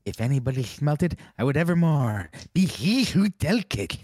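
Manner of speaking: loud, high theatrical recitation; movie line recital. Retold or imitated. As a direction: declaim it like a dramatic curse. Reciting Pee-wee movie quote.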